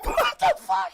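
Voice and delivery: high, shouted disbelief; shocked shout. Real, not acted. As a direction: yell it in total shock.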